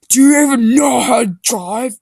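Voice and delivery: high, loud scoffing voice; mocking voice. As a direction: sneer at her driving. John mocking Meg's driving.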